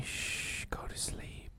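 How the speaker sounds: hushed unvoiced whisper; whispering over a crib. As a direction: breathe it like soothing a child. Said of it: "and he tells me".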